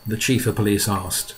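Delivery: ordinary delivery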